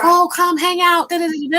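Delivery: high, sing-out imitation of inviting relatives; mock breezy invitation. Relatives inviting her to hang out, mock breezy. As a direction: pitch it up, coaxing them along.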